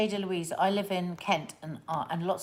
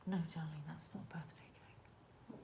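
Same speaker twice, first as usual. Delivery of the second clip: hushed whisper to her partner; 17 dB quieter than usual. Real, not acted. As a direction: keep it secret, barely voiced. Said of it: "Why are you whispering so quietly?".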